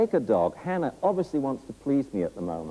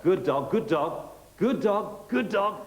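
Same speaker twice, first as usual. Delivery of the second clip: rising higher and higher, laughing good dog; excited praise; 11 semitones higher, much slower than usual. Real, not acted. Trainer heaping praise on the volunteer as she finally gets it. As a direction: heap on joyful praise.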